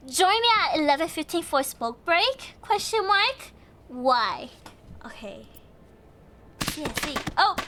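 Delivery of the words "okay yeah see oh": ordinary talk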